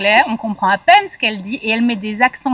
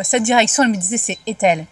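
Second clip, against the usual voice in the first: ordinary talk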